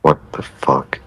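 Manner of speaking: startled whisper. Real, not acted. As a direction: breathe it out in shock. Startled by a strange voice.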